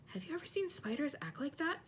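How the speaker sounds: softly amazed, a touch of awe; wonderingly. A narrator's reading. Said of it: "said Hermione wonderingly".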